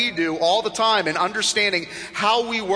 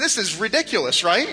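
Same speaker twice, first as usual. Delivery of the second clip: ordinary talk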